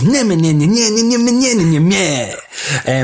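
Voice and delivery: high, whining "nyeh" babble; silly nonsense noises. Pretend. Host throwing silly noises into the show intro, nonsense babble.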